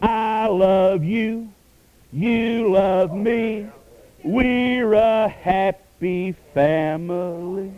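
slow, sing-song kiddie dinosaur voice; Barney voice. Retold or imitated. Preacher mocking Barney's message to the congregation, slow sing-song kiddie voice. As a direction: coo it slowly like a children's TV dinosaur. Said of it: "Barney, the purple dinosaur, could preach his message".

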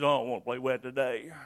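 low, soft and fast sulky mumble; preacher voicing a child refusing again; sulky refusal. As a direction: brush it off like a pouting kid. Children's game quarrel acted out.

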